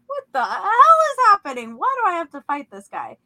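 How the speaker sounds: incredulous protest, swooping up at the start; retold disbelief. Dreamer challenged to fight by a man, incredulous.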